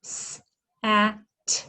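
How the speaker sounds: S, a, t said apart; each phoneme said separately; segmenting sounds. Shown as a demonstration. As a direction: sound out sat one by one. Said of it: "What are the sounds?".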